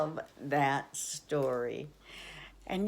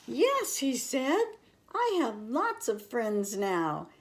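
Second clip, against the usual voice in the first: happy; 6 semitones higher, 5 dB louder than usual. A narrator's reading. Little Beaver happily claims friends.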